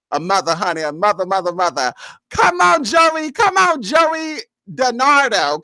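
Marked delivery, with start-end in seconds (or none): 2.3-5.6 excited shout-out. Real, not acted. Host calling out welcome to viewer.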